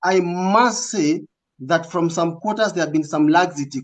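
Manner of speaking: ordinary delivery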